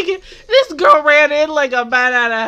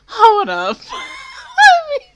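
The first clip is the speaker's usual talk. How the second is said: laughing disbelief; 6 dB louder, slower than usual. Real, not acted. Shocked laughing reaction.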